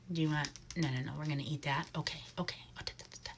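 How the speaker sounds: hushed, gentle pet talk; soft cooing to bird. Real, not acted. Keeper coos softly to the owl on her glove.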